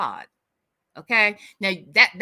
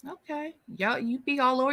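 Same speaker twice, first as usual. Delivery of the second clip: normal talking voice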